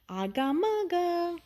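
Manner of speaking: high playful babble; baby babble. Acted. A little one babbles to the new neighbor, high and playful. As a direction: babble like a toddler.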